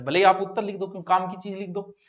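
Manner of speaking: usual speaking voice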